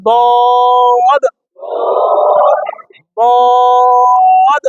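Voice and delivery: বদ pulled long and strong; four-beat madd demo; Arabic dad sound. Shown as a demonstration. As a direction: stretch বদ, four alif long. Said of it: "বললাম".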